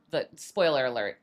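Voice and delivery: ordinary delivery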